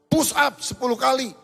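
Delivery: loud, high order from a teacher; teacher snapping an order at a swearing student; stern command. Retold or imitated. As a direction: bark the punishment at the student.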